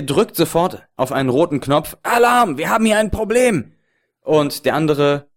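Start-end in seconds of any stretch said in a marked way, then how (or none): 2.0-3.7 high, loud panicked officer call; alarm shout. Acted. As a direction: yell for backup, much higher. Officer hits alarm button.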